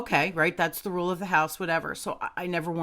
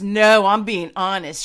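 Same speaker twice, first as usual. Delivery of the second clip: louder, emphatic protest as the husband; mock insistence; 8 dB louder than usual. Husband assuring his doubting wife, mildly loud and insistent. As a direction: imitate him insisting, with extra volume.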